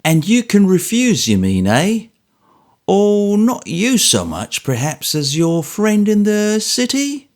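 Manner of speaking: high, slow, mocking old man's voice; old Smallweed sneering at George in high mock politeness; ogreish jocularity. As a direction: tease him like a gloating ogre. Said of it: "an ogreish kind of jocularity".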